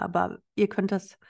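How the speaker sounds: usual speaking voice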